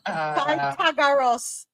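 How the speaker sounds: Pythagoras said very slowly as eye-ta-go-ras; slow careful Pythagoras; stretched Pythagoras, dropping the P. Shown as a demonstration. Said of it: "You pronounced it now".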